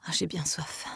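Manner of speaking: faint, breathless whisper of thirst; sighing whisper. A narrator's reading. Dying Emma asks for water, whispered sigh. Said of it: "soupira-t-elle".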